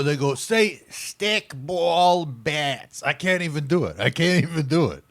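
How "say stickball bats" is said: imitating a speech coach.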